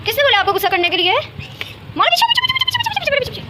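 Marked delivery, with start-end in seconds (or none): 1.1-3.4 very high squeal, repeated over and over; squealed shooing. Real, not acted. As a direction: shoo the pet back in a squeaky voice. Squealing at pet to get back. (बहुत ऊँची, चीं-चीं आवाज़ में)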